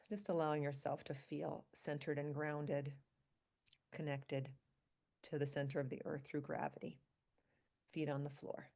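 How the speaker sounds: ordinary talk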